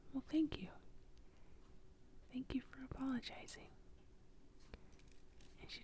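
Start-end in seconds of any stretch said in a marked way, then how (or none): 0.1-3.7 whispered little onion, pitched higher; a shy little onion whispering its thanks, higher up. Acted. The little onion thanking Missus Tomato for apologizing, a higher whisper. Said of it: "the little onion ... says".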